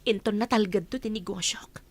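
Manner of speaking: ordinary talk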